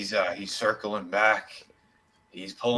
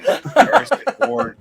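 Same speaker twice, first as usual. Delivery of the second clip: laughing; 14 semitones higher, 8 dB louder, slower than usual. Real, not acted. Laughing while mocking the blog.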